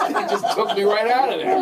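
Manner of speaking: normal talking voice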